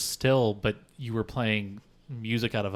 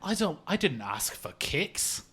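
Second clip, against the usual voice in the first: put-on British accent; 8 semitones higher than usual. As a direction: bellow like a Brit cheated of breakfast. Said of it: "I'm angry about breakfast".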